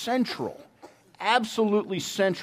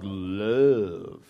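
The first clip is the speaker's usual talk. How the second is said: low, sweetly drawn-out word; sweet and long; 7 semitones lower than usual. Shown as a demonstration. Preacher guessing how the priest said his one-word sermon, sweet and long. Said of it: "Did he say it sweet and long".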